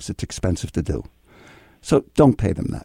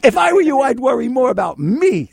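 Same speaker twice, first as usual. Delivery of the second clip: yelling; 11 semitones higher, 4 dB louder than usual. Real, not acted. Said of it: "I'm yelling and screaming here".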